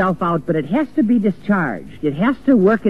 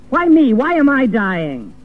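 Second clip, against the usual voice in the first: anguished angry cry; 7 semitones higher than usual. Retold or imitated. A dying man raging at the doctors, anguished angry cry. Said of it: "he goes into a stage of anger".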